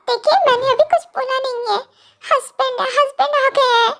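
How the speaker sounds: high, tremulous warning; frightened girl's voice. Acted. Kookie says she hasn't spoken, husband has come, frightened. Scared wife announces husband's arrival.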